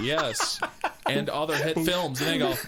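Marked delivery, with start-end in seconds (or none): 0.0-0.6 high, laughing yes; high mock answer. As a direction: answer with a giggle. Actor answers fan.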